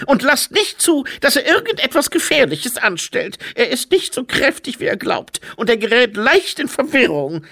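very high, loud scolding; bossy, high-pitched admonishment; sharp. A narrator's reading. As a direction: warn them sharply, as a protective wife. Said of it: "sagte Frau Krieg scharf".

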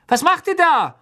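high, loud startled calls; alarmed shout. A narrator's reading. A boy woken by a scream, shouting out in alarm.

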